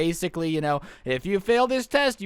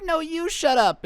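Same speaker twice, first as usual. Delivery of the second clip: much higher, slow petulant whine; a bratty kid throwing a tantrum, pitched way up; hissy fit; 10 semitones higher, slower than usual. Retold or imitated. Kid snaps back at the class in a high, slow whine. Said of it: "he throws a little hissy fit and is like".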